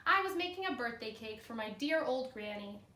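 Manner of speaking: expressive read-aloud storytelling; cheerful classroom story reading; story narration. A narrator's reading. Teacher reads the story aloud.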